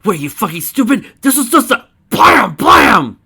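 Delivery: high, very loud outburst; wailing full-force yell; frantic screaming. Acted. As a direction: scream it in desperate protest. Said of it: "the man screaming".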